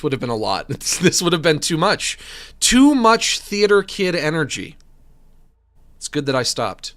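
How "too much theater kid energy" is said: louder, higher, theatrical flourish; theater kid energy. Pretend. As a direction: show off like an overeager drama student. Sending up own theatrical acting.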